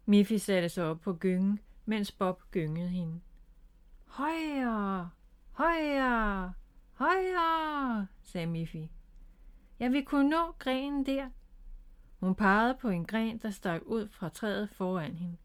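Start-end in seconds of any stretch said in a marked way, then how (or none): 4.0-8.1 long drawn-out cries, climbing higher; gleeful urging. A narrator's reading. Miffy calls from the swing to Bob, gleeful. 9.8-11.3 put-on high, eager child's voice. A narrator's reading. Miffy on the swing tells Bob her aim, eager.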